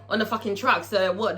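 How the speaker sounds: ordinary delivery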